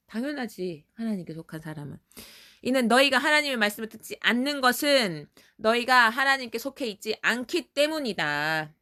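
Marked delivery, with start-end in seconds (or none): 2.6-8.7 firm, emphatic formal Jesus; Jesus reading. A narrator's reading. Jesus rebuking those not of God, mildly stern formal voice. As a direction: read it as a rebuke, stretching the phrase endings. Reads Jesus' words to a child.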